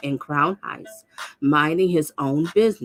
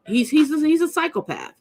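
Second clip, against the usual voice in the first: higher, indignant insistence; outraged; 10 semitones higher than usual. Real, not acted. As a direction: call him out in disbelief. Outraged commentary on criminal.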